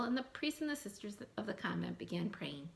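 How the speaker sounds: ordinary delivery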